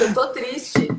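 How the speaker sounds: usual speaking voice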